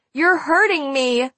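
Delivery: high, drawn-out cry of pain; pained, pleading shriek. A narrator's reading. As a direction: cry out slowly, pitch well up. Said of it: "I shrieked".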